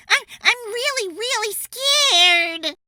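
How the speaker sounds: very high, very loud, stretched slow; terrified scream. Acted. Jim panicking in the dark. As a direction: wail it out in panic.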